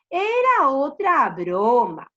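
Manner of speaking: loud, slow, drawn-out reveal; gleeful prankster. Acted. Shepherd admits another joke to villagers, gloating. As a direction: draw it out with relish. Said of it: "él se rió y les dijo".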